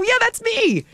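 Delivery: high sheepish reply. Retold or imitated. Retelling her own reply.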